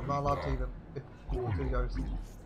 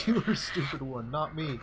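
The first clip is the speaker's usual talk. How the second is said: laughing gamorrean taunt; slower than usual. Acted. Gamorrean insult match, laughing.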